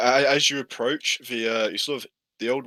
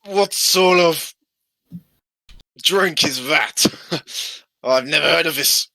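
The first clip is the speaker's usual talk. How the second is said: put-on drunk old man; 5 semitones higher, 7 dB louder, slower than usual. Drunk man scoffs at water.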